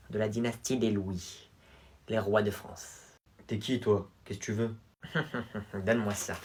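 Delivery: put-on haughty rich boy. Louis vingt sneering at the new pupil. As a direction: look down on the newcomer.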